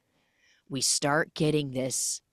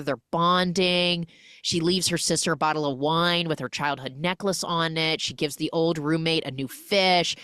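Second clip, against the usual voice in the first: normal talking voice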